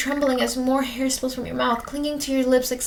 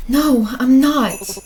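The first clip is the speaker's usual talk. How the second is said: loud, drawn-out protest; slow, emphatic acted cry; desperate denial; 7 dB louder, much slower than usual. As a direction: deny it loudly, stretching the words. Desperate denial to accusing ghost.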